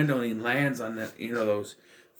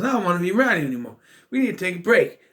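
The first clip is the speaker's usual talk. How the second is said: retold complaint; 6 semitones higher, 4 dB louder, much faster than usual. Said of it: "He goes".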